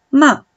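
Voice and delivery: MA short, loud, falling sharply; fourth tone. Shown as a demonstration. Teacher contrasting tones for student, MA falling. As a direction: drop MA from high pitch. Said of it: "el cuarto tono".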